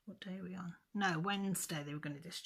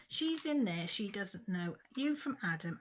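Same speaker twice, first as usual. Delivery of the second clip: retold furious outburst; 3 semitones higher than usual. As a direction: snap it back at her like you did that day. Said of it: "Well, I lost it".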